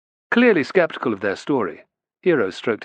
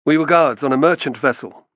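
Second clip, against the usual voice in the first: confident; 4 semitones higher, 5 dB louder than usual. Acted. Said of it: "in a confident voice".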